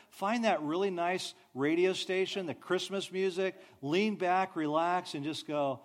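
ordinary talk, nothing marked

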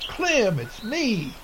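high call across the field. A narrator's reading. Rachel running toward her husband, calling out. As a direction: call out to him, pleading. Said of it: "she shouted".

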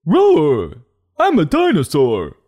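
hammed-up kid-book dinosaur announcing itself; roaring dinosaur quote. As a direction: bellow it like a cartoon dinosaur. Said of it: "I quote".

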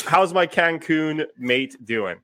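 ordinary talk, nothing marked

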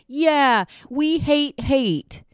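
high, loud, slow sneering voice; mocking impression. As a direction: drag out a smug taunt. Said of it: "you were just gonna be able to easily ride on".

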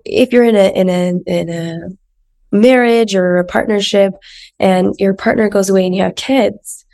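ordinary delivery

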